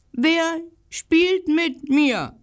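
high, slow, loud call of a lonely little dog; the little dog calling out loudly, slowly; loud bark. A narrator's reading. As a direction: bark the question out to the empty street. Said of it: "bellte er laut".

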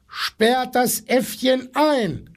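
high, slow, insistent call; demanding. A narrator's reading. Animals demanding action from the zookeeper, calling out high. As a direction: call it out like an angry crowd. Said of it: "verlangten die Tiere".

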